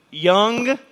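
put-on emphatic young after a pause; stressed young. Shown as a demonstration. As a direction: punch young against old. Contrast old to young.

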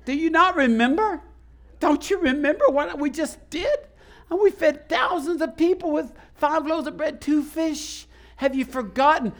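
Jesus reminding his disciples, gently scolding. Retold or imitated. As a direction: chide them patiently. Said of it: "he said".

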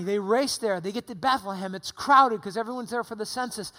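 ordinary talk, nothing marked

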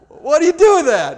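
high, loud baffled cry; stunned disbelief. Retold or imitated. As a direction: throw up your hands in disbelief. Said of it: "And I'm like".

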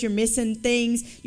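normal talking voice

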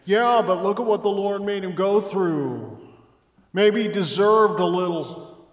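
a loud, high mocking voice of the doubters; mock the skeptic's excuse, loud; objecting listener. Preacher voicing a skeptic in the church, loud and mocking. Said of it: "some of you might say".

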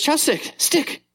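put-on high little-girl chirp; toddler voice. Dad imitating his daughter at sushi, high and chirpy. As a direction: clip it like a toddler learning the word. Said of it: "she goes".

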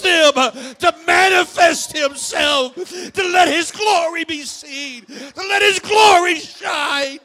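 loud, rising, shouting sermon; preaching at full force. Real, not acted.

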